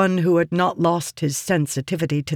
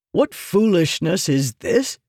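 demanding; 3 semitones higher than usual. A narrator's reading. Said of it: "Meridas demanded".

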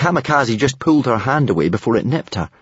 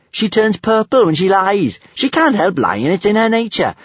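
high-pitched, louder gleeful telling; grinning; 11 semitones higher, 5 dB louder than usual. A narrator's reading. Kamikaze explains the dragon's lying, grinning. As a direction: tell on her with a grin. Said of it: "grinned Kamikaze".

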